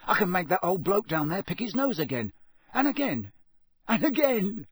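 put-on boy delighted with a gadget; excited teen. A narrator's reading. Ron excited.

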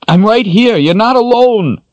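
desperate shout. Acted.

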